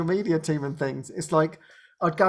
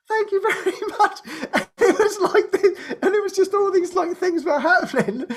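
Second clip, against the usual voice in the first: laughing; 10 semitones higher than usual. Real, not acted. Laughing thanks, things happening.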